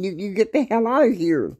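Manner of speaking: high-pitched imitated yell, stuttering at the start; mock screaming. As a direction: shriek it again, kicking him out. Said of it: "she keeps screaming".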